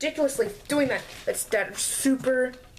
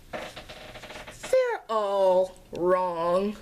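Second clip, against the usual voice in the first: dragged out; 3 semitones lower, much slower than usual. Real, not acted. Drawn-out punchline.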